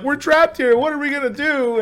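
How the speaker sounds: a hint of hammed-up fear; mock panic. Characters panicking in collapse.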